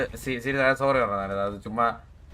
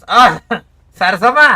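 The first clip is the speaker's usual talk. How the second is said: loud, higher call across the distance; 7 semitones higher, 13 dB louder, much slower than usual. Real, not acted. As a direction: shout her name to get her attention. Calling out to Sarasamma. (ഉറക്കെ പേര് വിളിക്കുന്നു)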